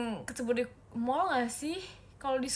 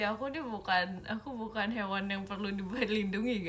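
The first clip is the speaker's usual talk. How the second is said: ordinary talk, nothing marked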